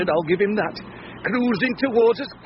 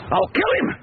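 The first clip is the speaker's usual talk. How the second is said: angry outburst; 6 semitones higher than usual. Acted. Husband vowing revenge on the rival, furious. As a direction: spit out the threat.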